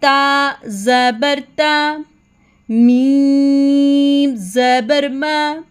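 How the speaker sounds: very slow chanted "ता ज़बर ता", syllables held; spelling-out drill. Shown as a demonstration. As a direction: stretch each syllable, as in "बा ता मा". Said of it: "letter के ऊपर पढ़ना है". ("बा ता मा" बहुत धीरे, खींचकर पढ़ें)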